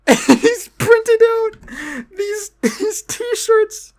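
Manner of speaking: high, stretched-out laugh-talk. Real, not acted. Describing the printed t-shirts, slow teary laughter. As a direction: squeeze the words out between laughs. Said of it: "It's so fucking funny".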